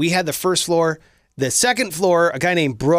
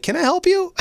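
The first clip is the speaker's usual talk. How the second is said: high, sweet mock politeness; overly polite; 13 semitones higher than usual. [name] cracks the door to the armed stranger, high mock polite. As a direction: play it innocent and cheery. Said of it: "I'm like".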